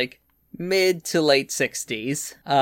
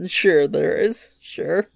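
sarcastic; 2 semitones higher, slower than usual. Real, not acted. Sarcastic reply to method claim.

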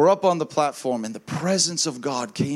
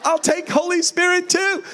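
laughing; 12 semitones higher than usual. Retold or imitated. Lady eagerly agreeing.

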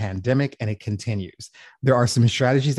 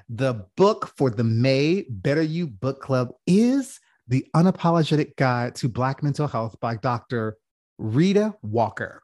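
ordinary talk

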